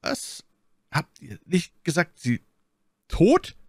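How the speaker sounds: halting, high-pitched question leaping up at the end; falter, then cry out the last word; stunned disbelief. A narrator's reading. Cardinal unable to believe My Lady is dead, stunned. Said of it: "nicht gleich glauben konnte".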